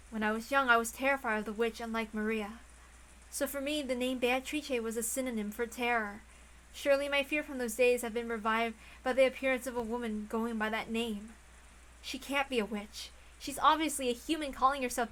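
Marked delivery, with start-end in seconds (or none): none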